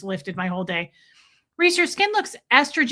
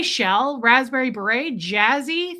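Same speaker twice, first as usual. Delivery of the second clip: normal talking voice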